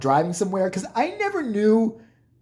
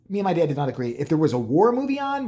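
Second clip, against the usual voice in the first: ordinary talk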